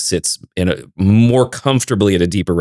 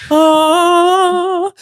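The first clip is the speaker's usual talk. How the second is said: gasp; 4 dB louder than usual. Pretend. Mock sucking up a voice.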